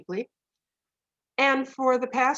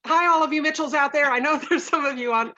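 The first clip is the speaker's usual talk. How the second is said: bright hello, higher and louder; sudden raised shout-out with a laugh; calling out greeting; 6 semitones higher, 7 dB louder, faster than usual. Real, not acted. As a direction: call out to relatives watching. Greets in-laws watching online.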